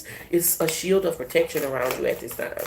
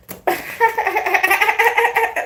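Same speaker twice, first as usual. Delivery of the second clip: high, far-off shrieking cackle; 3 dB louder than usual. Real, not acted. Laughing at her own taunt, high cackle. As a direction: let a high cackle rip.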